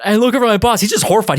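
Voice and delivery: normal talking voice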